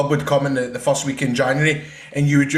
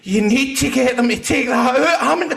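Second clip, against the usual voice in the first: high, loud mock indignant mum; mum's voice; 11 semitones higher, 4 dB louder than usual. Mum demands the clip be cut, high and indignant. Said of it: "my mom was like".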